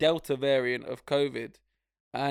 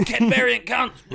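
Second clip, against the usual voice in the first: loud, high abusive shout; mock yell; 9 semitones higher, 8 dB louder, slower than usual. Thugs shouting abuse at people from Kent, loud mock yell. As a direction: hurl it like a thug across the street. Mock racist yelling in Margate.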